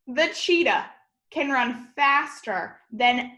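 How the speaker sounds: ordinary delivery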